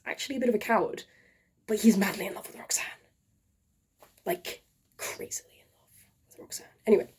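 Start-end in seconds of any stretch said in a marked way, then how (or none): 1.7-6.7 hushed, quick confiding whisper. A narrator's reading. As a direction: let it drop to a secret whisper.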